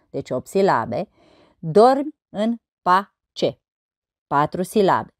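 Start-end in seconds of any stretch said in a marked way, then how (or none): 1.6-3.6 very slow, each syllable separated. Shown as a demonstration. Teacher counting verse syllables on fingers, syllable by syllable. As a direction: count out the syllables one at a time. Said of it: "Să observăm numărând".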